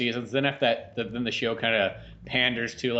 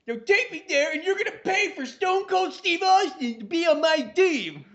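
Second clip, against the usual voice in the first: mock bratty yell; 14 semitones higher, 3 dB louder than usual. Kid voice ranting at mom.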